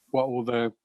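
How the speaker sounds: normal talking voice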